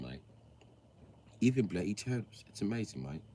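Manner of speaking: put-on soft, understated British accent; British presenter. British man sharing thumb facts with viewers, British accent. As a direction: explain it softly as a British know-it-all.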